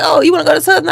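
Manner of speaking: high, lively mock professor voice; imitating the professor. Professor offering Southern to the student, lightly voiced high. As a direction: pitch the question up and brighten it. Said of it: "He was like".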